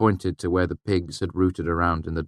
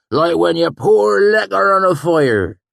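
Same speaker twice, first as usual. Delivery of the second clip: high, loud retort; hot-tempered swineherd; 13 semitones higher, 10 dB louder than usual. A narrator's reading. Sheem retorting hotly, high and loud. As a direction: snap back scornfully.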